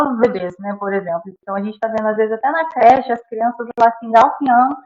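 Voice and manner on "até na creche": ordinary delivery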